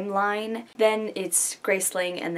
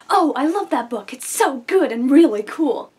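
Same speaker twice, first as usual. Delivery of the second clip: high, loud gushing fangirl; gushing fan; 5 semitones higher, 8 dB louder than usual. Retold or imitated. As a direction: gush about the book. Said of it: "it would be like".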